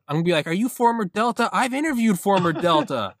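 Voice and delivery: high, worked-up comic arguing; mock indignant protest. Podcaster defending his credentials to assassins, mock indignant.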